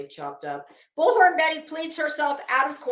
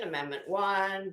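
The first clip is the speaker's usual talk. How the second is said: ordinary talk, nothing marked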